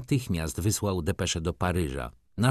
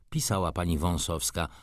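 ordinary delivery

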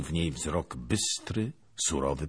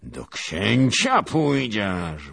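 slow, loud, higher taunt; mocking shout; 6 semitones higher, 9 dB louder, much slower than usual. Princess shouts a taunt at the lieutenant. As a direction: taunt him with slow menace. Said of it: "wołała kniaginia".

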